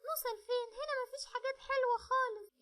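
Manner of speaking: put-on high small girl's voice, tired and scared; little girl. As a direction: whine like a worn-out little girl afraid of the dark. (بنت صغيرة تعبانة وخايفة، صوت عالي ورفيع)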